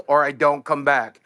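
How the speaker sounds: usual speaking voice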